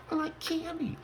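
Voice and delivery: very high, soft put-on voice; silly squeaky voice. Joking about a candy craving, silly squeaky voice.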